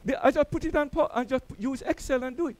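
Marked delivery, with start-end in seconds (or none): none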